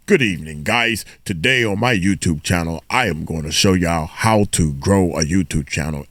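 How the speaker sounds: lightly mocking formal newscaster read; a hint of a stiff TV anchor. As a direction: poke fun at a YouTuber playing newsreader. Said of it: "They always wanna be some newscaster".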